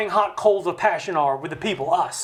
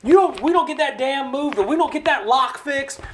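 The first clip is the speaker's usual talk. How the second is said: mock yelling; 6 semitones higher than usual. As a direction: play the congressman shouting for his voters. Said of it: "he yells and he screams for us".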